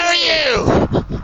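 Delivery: high, drawn-out holler; calling out. Real, not acted. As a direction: hail a stranger, stretched out. Calling out to a stranger.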